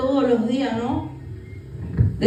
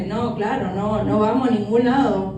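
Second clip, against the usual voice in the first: ordinary delivery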